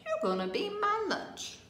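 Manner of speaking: high-pitched storybook cow voice; cow's threat. A narrator's reading. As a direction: swoop up high on the playful threat. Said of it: "The cow looked at the pancake and said".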